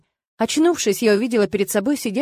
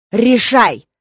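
louder growled demand; growling; 7 dB louder than usual. Acted. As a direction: growl the order. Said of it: "зарычал он".

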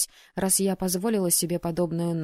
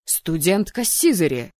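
loud roar of an angry dean; stern, loud call to order; 5 dB louder than usual. A narrator's reading. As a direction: bellow the student's name in reprimand. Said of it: "взревел декан".

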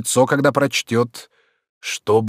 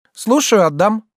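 put-on high, ready girl's reply; obliging young maid; 10 semitones higher, 4 dB louder than usual. A narrator's reading. Maid agrees to take the letter, high and obliging. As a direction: chirp a willing answer, pitched high.

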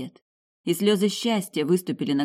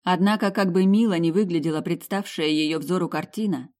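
usual speaking voice

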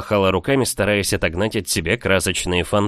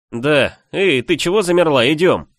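higher, louder impatient prompt; calling out; 8 semitones higher, 5 dB louder than usual. A narrator's reading. Friend urges frozen girl to walk, calling out. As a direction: call her along briskly.

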